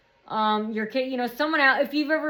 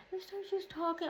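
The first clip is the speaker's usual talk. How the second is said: hushed, higher voice; imitating her soft talk; 7 semitones higher, 14 dB quieter than usual. Showing how she talked this time, hushed. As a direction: drop to a soft, high murmur. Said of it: "she was talking like this".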